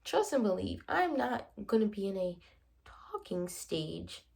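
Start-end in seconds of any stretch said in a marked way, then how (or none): 2.8-4.2 slow, whispered disgust at the phrase; a slow whispered sneer; scornful whisper. Real, not acted. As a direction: breathe the words like they taste bad. Disdain for the term.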